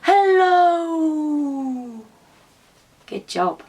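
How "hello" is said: put-on leading kids in an echoing hello, fading. Shown as a demonstration. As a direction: let each hello die away down the well. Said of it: "Again for me".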